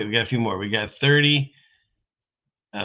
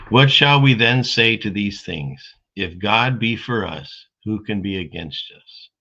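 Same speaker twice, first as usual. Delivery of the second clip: slow, emphatic verse reading, loud opening; scripture reading; 5 dB louder than usual. Acted. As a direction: read out the verse with weight, settling at the end. Reads a Bible verse aloud.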